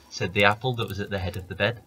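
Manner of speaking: ordinary delivery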